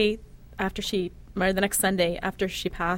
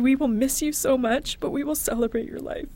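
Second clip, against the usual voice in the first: higher, tender soothing voice; comforting; 6 semitones higher than usual. Retold or imitated. Mother comforting her dying daughter, tender and higher. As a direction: soothe a frightened child.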